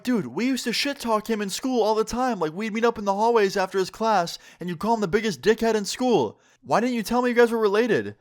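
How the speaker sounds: high-pitched, indignant protest; incredulous confrontation. Retold or imitated. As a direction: accuse a friend in disbelief, voice pushed up high. Confronts friend over hidden relation.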